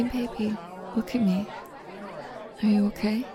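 soft, gentle reassurance; tender comfort. Acted. Comforting the hurt partner after the bully leaves, softly. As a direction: hold them close with the voice.

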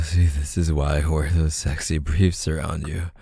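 ordinary talk, nothing marked

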